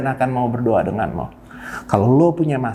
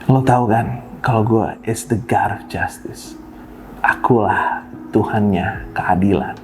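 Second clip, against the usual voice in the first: a little lower and slower, as God speaking; 5 semitones lower than usual. Retold or imitated. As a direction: say it as God, calm and slow. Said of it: "Tuhan selalu bilang". (suara Tuhan yang sedikit lebih rendah dan pelan)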